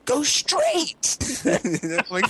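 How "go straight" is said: high, odd mock shout; pitched-up retold yell of directions. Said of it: "I'm, like, screaming at this guy".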